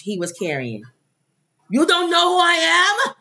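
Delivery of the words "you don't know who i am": Arnold Schwarzenegger voice. Retold or imitated. Detained star protesting to customs agents in a mock Arnold voice. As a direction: ham up an indignant Arnold at customs, laughing.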